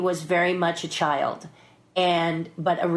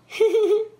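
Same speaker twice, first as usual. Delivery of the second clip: high little giggle; 12 semitones higher, 4 dB louder than usual. Retold or imitated. Actress imitating her co-star's reaction at the reading, giggly. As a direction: imitate a tiny, high-pitched titter. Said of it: "[name] looks at me and goes".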